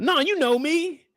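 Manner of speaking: high, whiny denial; squeaky defensive pleading; mock pleading man. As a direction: beg innocently in a high voice. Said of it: "He talking about".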